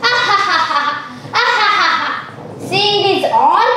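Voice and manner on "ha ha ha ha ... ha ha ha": spoken-out story laugh; mock laughing. Boy laughing at the fooled farmers, mock laughing. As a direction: act out the prankster's ha-ha laugh. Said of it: "he started laughing".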